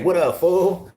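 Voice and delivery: high-pitched playful Cali hello; high Cali greeting. Retold or imitated. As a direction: fling it up high in a Cali imitation. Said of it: "what a lot of the California fools say".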